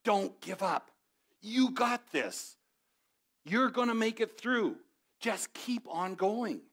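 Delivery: a Braveheart-style battle cry of encouragement; passionate yelling William Wallace. Retold or imitated. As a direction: fire them up like a freedom fighter before battle. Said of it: "strong, passionate voice yelling at you".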